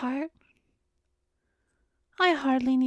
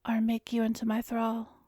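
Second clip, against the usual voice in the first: usual speaking voice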